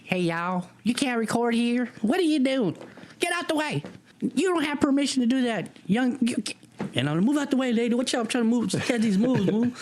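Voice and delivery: imitating a lady scolding the kid filming; bossy lady voice. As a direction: shoo the kid away like a gym lady. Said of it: "I'm just like".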